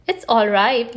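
plain reading voice

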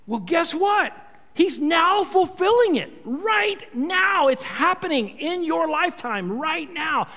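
like a preacher telling his people the promise has come; excited proclamation. Retold or imitated. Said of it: "he's saying to his fellow countrymen".

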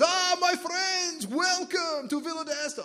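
booming, high, drawn-out Hungarian welcome; Hungarian accent. Acted. Gregarious winery owner welcomes visitors, exuberant Hungarian accent. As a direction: shout a slow, hammed-up Hungarian greeting. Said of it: "can't do a good Hungarian accent".

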